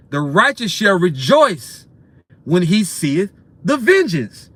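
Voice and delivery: slow, measured King James recital; drawn-out solemn reading of the psalm; solemn scripture reading. Shown as a demonstration. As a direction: intone the verse slowly. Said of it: "This is Psalms fifty-eight and verse ten".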